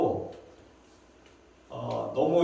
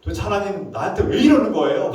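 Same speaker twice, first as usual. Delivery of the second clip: higher-pitched exasperated demand; rude confrontation; 4 semitones higher, 4 dB louder than usual. Acted. Prophet rudely asks God why me, exasperated. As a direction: push hard on the why, indignant. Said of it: "너무나 무례할 정도로 거세고".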